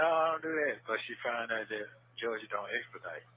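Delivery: ordinary delivery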